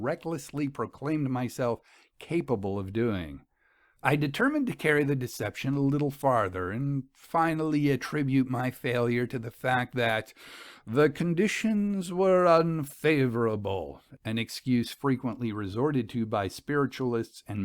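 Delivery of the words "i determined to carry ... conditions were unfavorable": usual speaking voice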